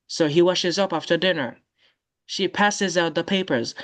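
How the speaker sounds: usual speaking voice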